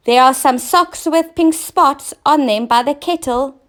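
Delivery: high, loud, impatient call; queen shouting back to the king; shouted back. Acted. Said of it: "she shouted back".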